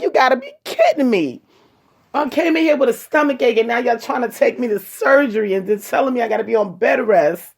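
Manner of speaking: high, worked-up disbelief, half laughing at it; sarcastically over the moon; mock ecstatic disbelief. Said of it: "like, ecstatic".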